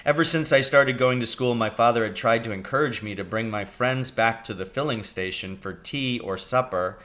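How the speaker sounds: usual speaking voice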